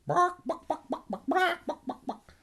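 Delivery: very high yappy barks; dog barking. Acted. Hypnotized Donald barking like a dog. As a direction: yap like a little dog.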